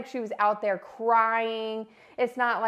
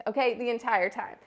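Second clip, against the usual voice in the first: usual speaking voice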